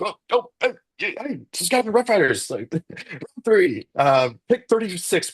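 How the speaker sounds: high-pitched mock cheer, half laughing; imitating excited family. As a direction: act out the relatives' surprised, high whooping. Retelling family's reaction to draft pick.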